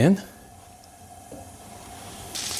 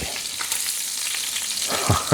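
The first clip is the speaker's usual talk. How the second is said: breathless giggle; 10 dB quieter than usual. Real, not acted. Excited chef giggles over scallops.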